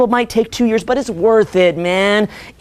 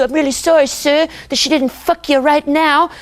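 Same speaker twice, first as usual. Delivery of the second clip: mock apology; 6 semitones higher than usual. Mock polite apology in sketch.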